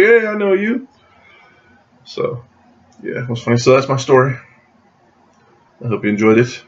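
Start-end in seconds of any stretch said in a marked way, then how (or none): 0.0-0.9 high imitated hello; delighted recognition. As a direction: re-enact spotting a familiar face, pitched up. Retold recognition by the actor.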